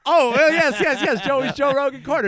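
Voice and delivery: loud, high showman intro; hammed-up host announcing his segment; mock podcast host. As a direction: ham up the show opener.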